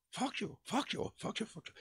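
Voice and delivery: high, soft mimicked repetition; mock foreign student trying out a phrase; imitating eager parroting. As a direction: parrot it back in a small high voice. Imitates King happily parroting new phrase.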